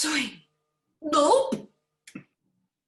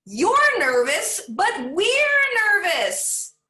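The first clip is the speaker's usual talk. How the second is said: louder, slow, stretched-out reply; eager loud reply; 6 semitones higher, 7 dB louder, much slower than usual. Acted. Students answer the nervous teacher.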